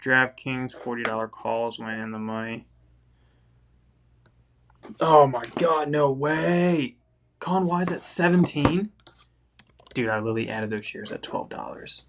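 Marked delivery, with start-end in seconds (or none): 5.0-8.9 high, loud thrilled disbelief; shrieking jackpot winner. Real, not acted. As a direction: shriek it like you just won. Sees stock soared, real shock.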